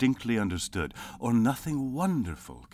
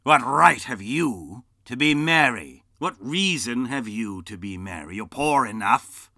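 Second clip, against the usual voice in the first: put-on loud, higher grumpy old miser; bitter Scrooge; 6 semitones higher, 9 dB louder than usual. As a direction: sneer at his merriment. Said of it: "returned the nephew".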